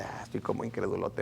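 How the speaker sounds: very low, hushed sceptical sigh; incredulous. Retold or imitated. Doubter beside a weeping worshipper, incredulous low murmur. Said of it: "algo incrédulo".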